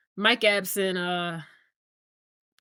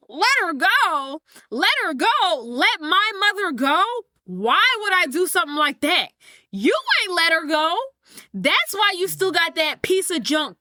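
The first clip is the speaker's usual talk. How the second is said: higher, heated acted retort; indignant outburst; 4 semitones higher than usual. Son throws "let her go" back at his father, indignant and heated. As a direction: fire it back, drawing out the key words.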